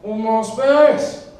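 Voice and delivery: high, loud, drawn-out mock yell; hammed-up roar with stretched words; drunken scream. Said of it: "as he screamed the words".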